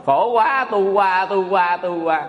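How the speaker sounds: long-vowelled mock wail, gradually falling; imitated baby cry. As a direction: mimic a newborn wailing, winding down. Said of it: "ông khóc". (giả tiếng em bé khóc kéo dài)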